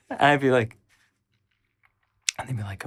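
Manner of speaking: ordinary talk, nothing marked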